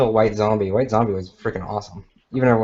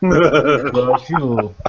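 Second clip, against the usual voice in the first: echoing the Russian boxer's threat; a deadpan movie-villain threat; Drago impression; 3 dB louder than usual.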